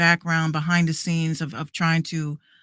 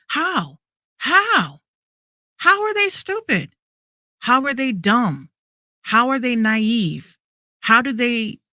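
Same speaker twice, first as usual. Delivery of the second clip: high-pitched, exasperated questioning; indignant disbelief; 5 semitones higher, slower than usual. Real, not acted. Podcaster defending artists called dumb, indignantly incredulous. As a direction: challenge them, each question its own outburst.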